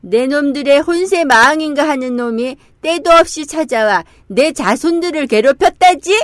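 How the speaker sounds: defiant shout. Acted. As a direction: call out boldly to the guards. Wukong shouts at the demon's gate. (당당하게 외치는 목소리)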